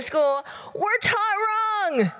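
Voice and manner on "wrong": ordinary talk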